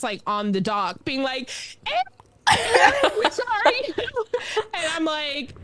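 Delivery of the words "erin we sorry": high, loud imitation of calling parents. Parents calling to daughter from the dock, high and loud. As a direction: holler up from the dock, half laughing.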